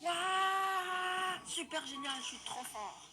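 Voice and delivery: loud, high whoop of joy; excited shout. Acted. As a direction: yell it as you shoot up. Jetpack takes off.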